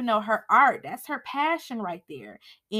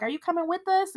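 higher, polite asking; a hint of a gentle dad; lightly voiced Uncle Phil; 8 semitones higher, faster than usual. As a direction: ask kindly as a father. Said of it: "Uncle Phil like".

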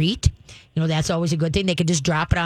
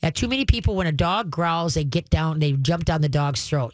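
ordinary talk

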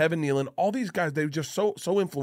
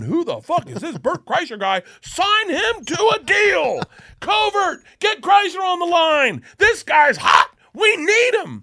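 much higher and louder frantic yelling; imagined Sandler shout; 10 dB louder, slower than usual. Pretend. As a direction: bark orders like a movie mogul.

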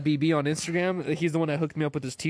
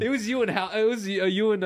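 normal talking voice

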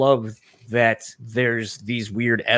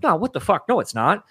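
higher, startled self-correction; correcting himself; 8 semitones higher than usual. Retold or imitated. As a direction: catch your own mistake in mock alarm. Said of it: "And I'm like".

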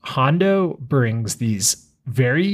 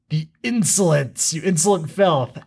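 higher, mock-menacing villain sneer; Maul voice; 6 semitones higher than usual. Sith villain Maul insulting the pirates, mock menace. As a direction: spit out the insult like a hammy villain. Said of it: "And Maul says".

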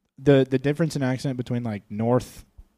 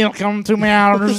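put-on loud, high, stretched Martian accent; made-up Martian accent; 6 semitones higher, 9 dB louder, slower than usual. Friend echoing the Martian greeting, loud silly Martian accent. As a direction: drag out an absurd Martian accent. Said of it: "that's gonna be the Martian accent".

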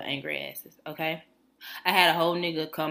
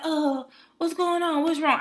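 high, louder mock-concerned manager; manager imitation; 12 semitones higher, 4 dB louder, slower than usual. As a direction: mimic the manager asking, pitched way up. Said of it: "her little stud manager come over".

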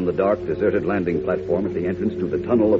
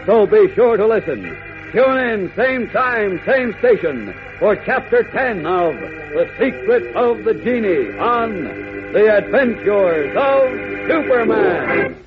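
excited radio announcer; 13 semitones higher, slower than usual. Acted. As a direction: sell tomorrow's smashing climax. Announcer promo for next episode.

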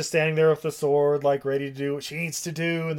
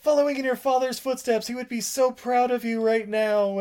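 high, eager exclamation; excited old friend; 9 semitones higher than usual. Retold or imitated. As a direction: gush with recognition. Dad's friend voiced, excited.

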